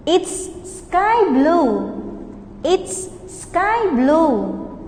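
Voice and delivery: very slow, stretched answer; slow model answer. Shown as a demonstration. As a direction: drag out sky blue for learners. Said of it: "practice repeating after me".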